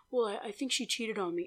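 soft, hesitant mock mumble; low-volume, halting impression; imitated hushed talk. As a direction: imitate him admitting it quietly. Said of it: "like, whispering".

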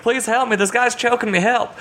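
high, mock-panicked begging; hammed-up high cry for help; retold desperate plea. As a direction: beg for rescue in a comically high voice. Retold plea while being choked.

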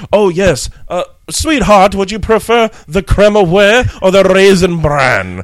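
put-on loud, high, grandly affected rich accent; snooty Hamptons socialite. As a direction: savour each breakfast option like pretentious summer-home gentry. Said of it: "only people from the Hamptons with a summer home".

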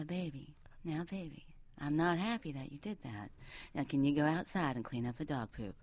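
very soft, coaxing Southern accent; whispered Southern accent. Shown as a demonstration. Wife coaxing husband to do chores, hushed Southern accent. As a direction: murmur it sweetly with a Southern lilt. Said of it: "whisper in a Southern accent and I say".

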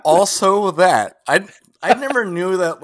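loud, slow, high joking agreement; mock emphatic. Real, not acted. Agreeing with a joke, loud and drawn out. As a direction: stretch it out for the joke.

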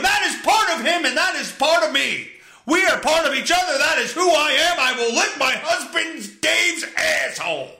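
high, fervent hammed-up shouting; overboard rant. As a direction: declare undying devotion at the top of your voice. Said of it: "That was a little overboard".

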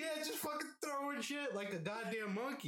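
high shouted through laughter; laughing shout. Real, not acted. Describing roommate throwing, high laughing shout.

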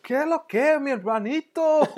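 high, loud mock Mexican accent. Imagining a Dominican greeting said by the actor, Mexican accent. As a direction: ham up a singsong Mexican accent. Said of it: "in Mexican".

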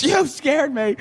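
slow, high-pitched mock wail; hammed-up scared squeal; imitating frightened friend. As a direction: whine it like a startled friend. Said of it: "I go, She's".

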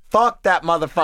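acted-out loud dismissal; retold outburst. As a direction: blurt it out emphatically, imitating her. Quoting her blunt outburst in story.

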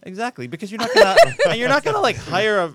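high, loud laughing agreement; blurting it out through laughter. Real, not acted.

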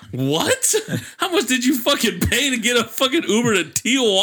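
laughing through the question; incredulous, cracking up mid-question; laughing disbelief. Real, not acted. Host laughing at the guest's wild story.

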